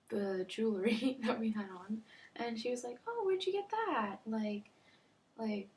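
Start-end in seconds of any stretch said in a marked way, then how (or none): 3.1-4.2 high, lilting casual question; nonchalant. Retold or imitated. Mom notices daughter's new necklace, nonchalant. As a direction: imitate a mom asking breezily. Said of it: "just, like, nonchalantly".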